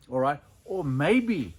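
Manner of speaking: ordinary talk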